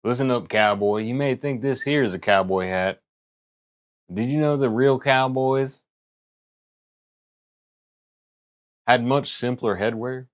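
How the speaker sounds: a hint of Western cowboy accent; light cowboy host voice. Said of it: "Cowboy accent".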